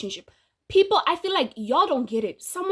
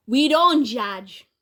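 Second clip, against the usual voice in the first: mock solemn; 5 dB louder, much slower than usual. Real, not acted. Mock-pious aside, slow.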